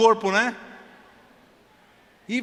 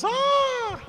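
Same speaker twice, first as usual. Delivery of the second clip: high swooning gasp; swooning squeal. Pretend. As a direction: squeal like a smitten fan. Girls swooning over Thor.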